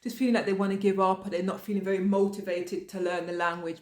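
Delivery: usual speaking voice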